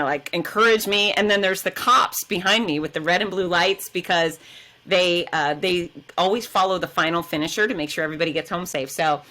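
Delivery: usual speaking voice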